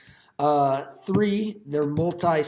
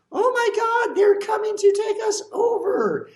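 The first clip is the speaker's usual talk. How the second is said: much higher, louder panicked shout; hammed-up fearful yell; mock panic; 4 dB louder than usual. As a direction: scream it like a scared alarmist. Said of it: "people are like".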